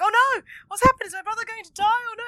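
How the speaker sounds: high, loud, hammed-up panic; mock panic. Voicing Joseph hearing Ryota is ill, hammed-up panic. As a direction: wail it like a frantic brother. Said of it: "the whole like".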